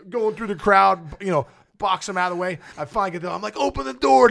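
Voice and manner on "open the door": high loud plea to open up; urgent yell. Retold or imitated. Fleeing an angry crowd, yelling at the bus driver. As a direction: shout for the door in a panic.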